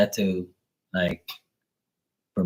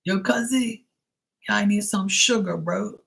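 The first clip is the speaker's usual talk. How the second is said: high, loose Bermudian slang voice; pitched-up Bermudian "yo, cousin" to a friend on the phone; Bermudian street greeting; 11 semitones higher than usual. Retold or imitated. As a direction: greet him like a Bermudian buddy. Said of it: "when I called the guy, I was like".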